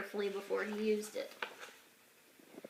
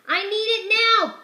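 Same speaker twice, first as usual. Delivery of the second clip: put-on childish demand; 8 semitones higher, 6 dB louder than usual. Sam reads with silly punctuation.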